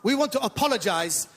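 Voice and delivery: put-on comic Iranian accent, eager to please. Comedian playing Iranian immigrant to the audience, put-on Iranian accent. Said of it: "a bit of an accent I do at the beginning".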